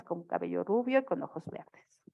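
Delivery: usual speaking voice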